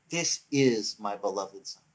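low, slow, subdued declaration; understated voice of God. Retold or imitated. Preacher closing on God's words from heaven, understated slow declaration. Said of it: "why would that voice from heaven cry".

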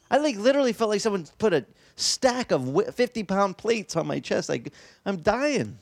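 normal talking voice

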